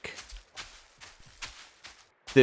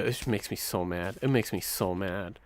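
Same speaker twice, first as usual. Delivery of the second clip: quiet seething; 4 semitones lower, 11 dB quieter than usual. Real, not acted. Repeated low complaint.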